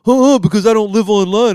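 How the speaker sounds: high, loud snooty comeback; mock smug reply. Imitating his own smug comeback to the troll, high and loud. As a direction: fire it back all smug and superior.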